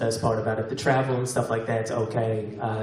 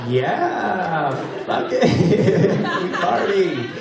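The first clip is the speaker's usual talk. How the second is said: hammed-up, higher-pitched half-convinced cheer; mock party enthusiasm; 5 semitones higher, much slower than usual. Comic teasing self-described party students, mock enthusiasm. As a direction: play students talking themselves into being wild.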